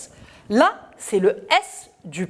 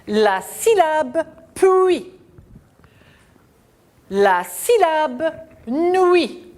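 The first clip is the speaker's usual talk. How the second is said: slow, high, syllable by syllable; slow model reading; 7 semitones higher, slower than usual. Shown as a demonstration. Teacher modelling a syllable for children. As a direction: read it slowly for the class to repeat. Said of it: "répéter après moi".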